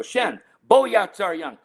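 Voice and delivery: retold dad's reprimand; parental scolding. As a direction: call the child's name and order him to stop. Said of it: "need to understand this phrase, 'Stop doing".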